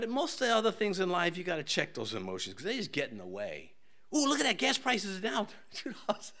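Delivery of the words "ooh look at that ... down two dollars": mock thrilled. Mock delight at gas prices.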